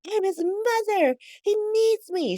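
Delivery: very high mock desperation; desperate pleading. Willow insisting on seeing her son, desperate.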